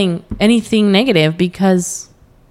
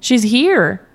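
imitated happy tears; 7 semitones higher than usual. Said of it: "It's happy tears".

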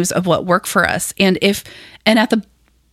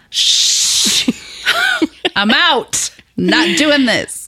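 hushing, then higher, drawn-out refusal; playfully acted-out balking, pitched up; mock refusal; 7 semitones higher, much slower than usual. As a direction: shush it and bow out slowly. Retells balking at husband's resolutions.

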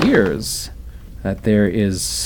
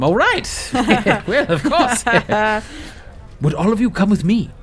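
laughing NPC; 11 semitones higher than usual. Acted.

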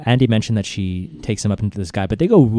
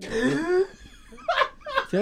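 laughing; 9 dB quieter than usual. Real, not acted. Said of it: "laughing at the growl attempt".

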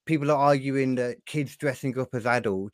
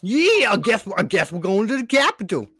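put-on much higher, louder dopey American accent; 9 semitones higher, 5 dB louder than usual. Rioter shrugging along to the Capitol, mock American accent. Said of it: "that's the one accent you can do".

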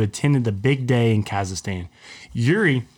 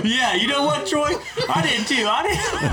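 laughing. Real, not acted. Laughing high reply.

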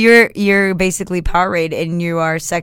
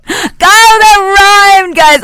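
excited; 13 semitones higher, 12 dB louder, much slower than usual. Real, not acted. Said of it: "spitting these raps right now".

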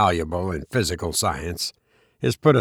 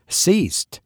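sudden high cry of hope; eager high exclamation; exclaimed; 9 semitones higher than usual. A narrator's reading. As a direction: leap up on the word. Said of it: "I exclaimed".

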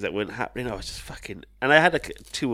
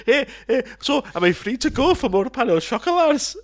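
put-on loud, high Scottish accent, jovial; jolly Scotsman; 8 dB louder than usual. Scotsman teasing the airport security guard, loud jolly Scottish accent. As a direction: boom it out like a cheery Scot at the airport. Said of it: "And he went".

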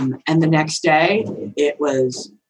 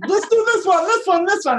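high, eager pleading for a dog; excited partner; 13 semitones higher than usual. Retold or imitated. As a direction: beg like a kid in a pet shop. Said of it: "He's like".

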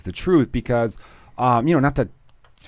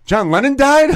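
put-on high, loud, shocked little-boy voice; child's shocked question; 12 semitones higher, 6 dB louder, slower than usual. Three-year-old son hearing that [name] died, shocked high voice. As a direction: play the toddler, stunned at the news. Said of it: "then my son goes".

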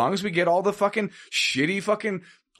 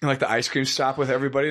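usual speaking voice

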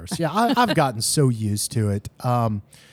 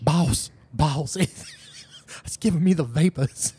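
hammed-up Southern damsel, laughing through it; bad community-theater Southern; 3 semitones higher than usual. Podcaster mocking the film's actress, hammed-up Southern accent. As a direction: overact like amateur theater doing Southern. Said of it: "sounds like a bad community theater reading".

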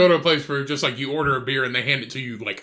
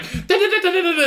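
very high babbling yell; mock yelling gibberish. Waitress yelling at the chef, very high babble. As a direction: rattle off fake orders at the cook. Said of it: "yelling at the fucking chef".

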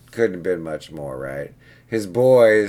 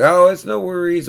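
a light, higher, breezy client voice; breezy client imitation; 11 semitones higher, 7 dB louder than usual. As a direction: toss it off like it's nothing. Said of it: "So he's like".

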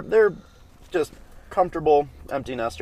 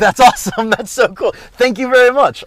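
delighted; 13 semitones higher, 11 dB louder than usual. Real, not acted. Delighted surprise at host's role.

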